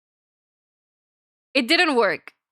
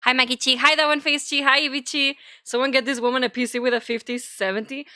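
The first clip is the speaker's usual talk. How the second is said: normal talking voice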